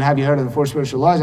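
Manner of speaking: rapid rote recital; rattled-off pitch. Retold or imitated. Preacher mocks his old rote pitch, rushing it fast. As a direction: reel off the question fast like a script. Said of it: "instead of going".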